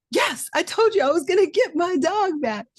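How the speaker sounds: high and loud, triumphant; telling her husband she got the dog back, triumphant; triumphant reply. Retold or imitated. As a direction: crow to the husband that she was right.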